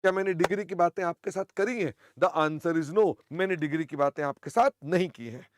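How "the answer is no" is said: ordinary talk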